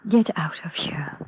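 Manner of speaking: whispered, slow and venomous; furious whisper. Acted. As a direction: hiss it so the guest won't hear. Mother sends daughter away.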